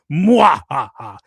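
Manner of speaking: slow, low, hammy villain with an evil laugh; cackle, then declare it deep and drawn out; mock-evil villain in a thick fake accent; Doom. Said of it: "It sounds like Arnold Schwarzenegger".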